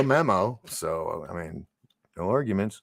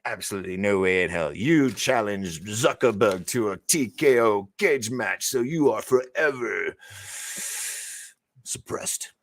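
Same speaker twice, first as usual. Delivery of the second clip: mock official verdict. Voicing YouTube's reply.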